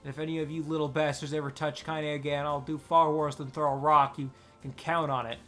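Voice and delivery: put-on loud, furious threat from an old woman; old woman's enraged threat; thick with rage. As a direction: bark it at the children like a warning. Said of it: "in a voice thick with rage".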